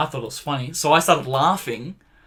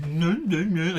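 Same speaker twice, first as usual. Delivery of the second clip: mock dim-witted kid, slightly raised pitch; dopey head; 3 semitones higher, much slower than usual. Mocking his edited nine-year-old self, dopey head voice. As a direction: play it lightly like a dopey child. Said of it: "He's fucking dopey head going".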